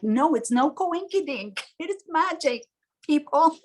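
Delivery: high, gleeful it is magic; proudly declaring magic to the doubters; excited insisting. Retold or imitated. Telling everyone it's no coincidence, high and gleeful.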